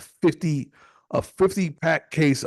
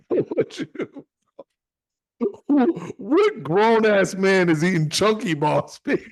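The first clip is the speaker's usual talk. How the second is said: mock disgust; 7 semitones higher than usual. Real, not acted. Mocking friend's candy choice.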